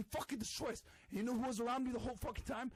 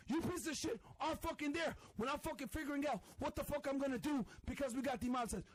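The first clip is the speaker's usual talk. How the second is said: ordinary talk, nothing marked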